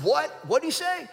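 high, loud sudden jolt of surprise; sit bolt upright: What?; startled. Retold or imitated. Jolted off the couch by the reporter's news, high and loud. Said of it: "When he said that, I sat right up".

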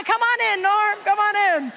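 loud, high welcoming call; calling someone in. Acted. Pastor welcoming a new volunteer in, calling out loudly. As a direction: call him in across the room.

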